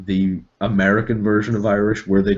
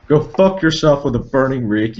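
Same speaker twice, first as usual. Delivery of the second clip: louder, higher furious insult; mock drunk villain snarl; 6 semitones higher, 4 dB louder than usual. Voice actor telling off his director, furious snarl. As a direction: spit it at the director. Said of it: "That was the take".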